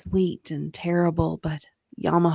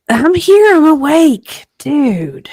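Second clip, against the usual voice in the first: loud, high, just woken up; startled shout; 9 semitones higher, 12 dB louder than usual. Real, not acted. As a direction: insist you're awake, loudly. Jolted awake, protesting.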